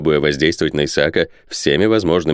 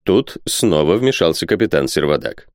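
ordinary delivery

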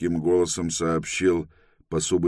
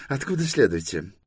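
high official's question; interrogating inspector; 6 semitones higher than usual. Acted. Inspector asks Торрен where they come from, officious.

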